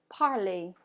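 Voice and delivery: "parler" as "par-lay", final R silent; French pronunciation. Shown as a demonstration. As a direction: model "parler" with its ending rhyming with play. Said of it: "repeat after me".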